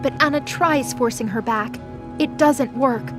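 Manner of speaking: ordinary delivery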